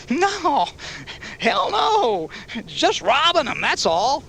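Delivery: very high, loud frantic denial; panicked denial. A narrator's reading. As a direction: yelp it out in panic. Biker denies at gunpoint.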